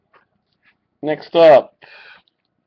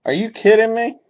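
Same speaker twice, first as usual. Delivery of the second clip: excited disbelief; 9 semitones higher, faster than usual. Real, not acted. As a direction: pitch jumps way up on kidding. Second pull of the same card.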